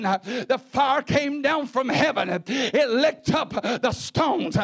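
building shouted sermon climax; climactic preaching. Real, not acted. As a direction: let the fire fall, word by word.

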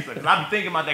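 ordinary talk, nothing marked

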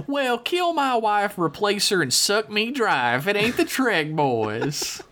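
slow, high country drawl; folksy hillbilly letter-writer. Acted. Host reading David S.'s email to the crew, hammed-up country drawl. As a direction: read it as a drawling backwoods yokel. Reads listener email in character.

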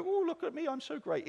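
high, hammed-up self-praise; mock a smug show-off; mock boasting. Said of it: "look at ourselves and go".

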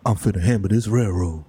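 low, grave hero voice; deep, solemn declaration; stoic railroad hero. Acted. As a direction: play the determined working man, very low. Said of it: "And he's just like".

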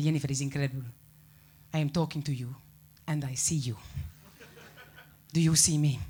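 Spanish mum, amazed by Skype; amazed mum in a Spanish accent. Retold or imitated. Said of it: "a very strong accent like this".

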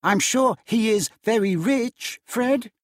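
hint slyly; wife dropping a hint to her husband. A narrator's reading. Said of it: "hinted Scrooge's niece".

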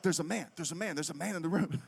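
soft, rapid repeated murmur; mock frightened hush. Husband under the sheets telling his wife, in a mock-scared hush. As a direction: parrot a scared warning under the breath. Copies wife's whispered warning back to her.

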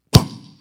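sudden loud bang; imitated crash; crash sound. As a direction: slam it like a door on a tree. Door hits tree.

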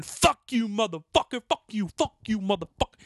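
high-pitched imitation of angry yelling; retold screamed abuse. Homeless man threatening the teller, imitated screaming. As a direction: mimic a man hurling curses. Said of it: "And he's screaming".

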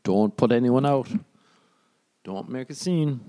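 high, put-on reproving voice; mock fussy family warning.

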